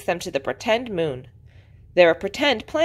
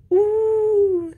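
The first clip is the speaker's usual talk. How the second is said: tiny high-pitched "ooh"; little piglet's high, awed voice; very small voice. A narrator's reading. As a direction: squeak out a long, small ooh. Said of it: "in a very small voice".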